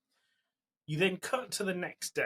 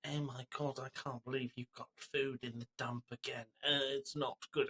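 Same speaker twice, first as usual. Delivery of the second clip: put-on softer, half-hearted Eastern European mum accent; mock Eastern European mum; 2 semitones higher, 5 dB quieter than usual. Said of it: "And the mum's like".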